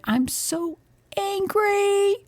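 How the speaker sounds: put-on high, strained little-kid voice; high, drawn-out child's complaint; imitating upset child. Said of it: "he was upset".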